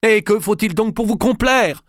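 very high, loud exasperation; furious shout. Acted. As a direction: hurl the question at her. King shouts at refusing wife. (roi furieux qui crie sur sa femme)

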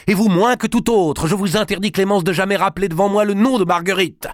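furious high cry. Acted. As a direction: cry it out in anger. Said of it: "cria-t-il".

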